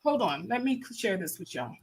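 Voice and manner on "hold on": ordinary talk, nothing marked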